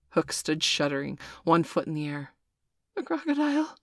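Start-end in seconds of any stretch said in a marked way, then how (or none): 3.0-3.8 high, breathless gasp of terror; a pirate captain gasps at the crocodile; gasped in fright. A narrator's reading. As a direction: gasp it out, suddenly terrified. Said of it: "he gasped".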